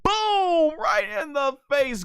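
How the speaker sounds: high, booming fight call; excited play-by-play. Real, not acted. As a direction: explode on the hit. Play-by-play of a big punch.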